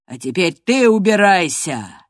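loud, forceful order; commanding. A narrator's reading. Master orders Earnshaw away, commanding loudly. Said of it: "велел он Эрншо".

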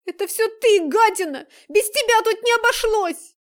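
high, loud spiteful yell; angry shout. Acted. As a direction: hurl the accusation. Nastya turns on Daineka.

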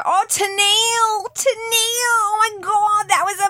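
put-on high, slow, drawn-out Queensland accent. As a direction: overdo a broad Queensland accent. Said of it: "screaming her name in a Queenslander accent".

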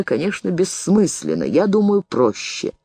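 usual speaking voice

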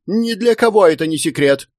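high-pitched cry back at him; indignant wife crying out; shrieked retort. A narrator's reading. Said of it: "крикнула она".

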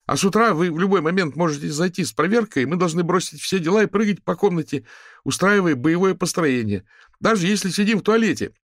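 high, loud ringing student voice. A narrator's reading. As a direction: ring out across the square. Student continues his complaint.

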